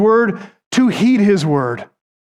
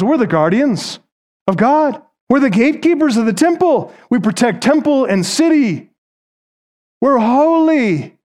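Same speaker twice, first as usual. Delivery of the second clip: higher, proud mock boasting; smug temple leaders; 6 semitones higher than usual. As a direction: play the arrogant gatekeepers, dragging out holy. Parodying self-righteous temple leaders.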